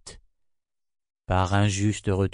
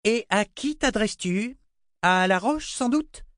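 high, mocking queen's voice; Queen Violette; 13 semitones higher than usual. A narrator's reading. Queen mocking the girl, high and scornful. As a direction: sneer down at the girl.

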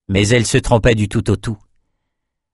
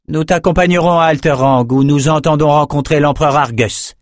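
higher, cool sorceress voice; a proud sorceress, pitched up; Nicci character voice; 6 semitones higher than usual. Acted. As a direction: speak down to the messenger, icy and sure. Nicci commands the messenger.

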